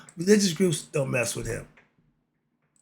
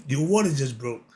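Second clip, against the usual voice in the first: ordinary talk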